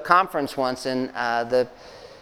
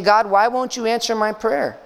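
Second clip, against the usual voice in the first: higher, pained plea; pleading question; 6 semitones higher than usual. Retold or imitated. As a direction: ask God why he stays silent. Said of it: "it's like he's saying".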